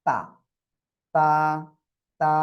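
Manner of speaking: তা with tongue tip on upper tooth ridge; pronounce তা. Shown as a demonstration. Teacher drilling তা for students. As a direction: say তা slowly, tongue at the teeth roots. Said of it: "একালিপ উচ্চারণ করবেন".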